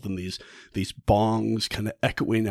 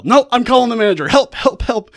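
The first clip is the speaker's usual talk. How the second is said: higher, louder, fast cries for help; panicked shouting; 12 semitones higher, 8 dB louder than usual. Retold or imitated. Helen panics in the theater, shouting for help. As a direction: scream for the manager in panic. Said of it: "Helen raises holy hell".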